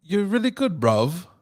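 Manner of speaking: high mock British patter; British accent. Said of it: "give me your British accent".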